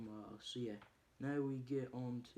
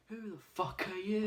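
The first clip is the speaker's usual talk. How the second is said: high, loud mock demand; retold tough guy confronting thieves; mock angry challenge; 10 semitones higher, 9 dB louder than usual. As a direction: bark it at intruders.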